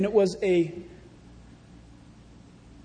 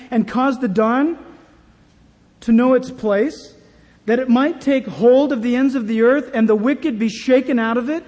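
preaching scripture; 3 semitones higher, 5 dB louder than usual. Acted. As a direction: thunder out the verses of Job. Preacher reads Job aloud.

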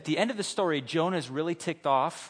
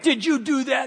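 higher, louder mock scold; scolding the dog; 9 semitones higher, 5 dB louder than usual. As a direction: ask the dog accusingly.

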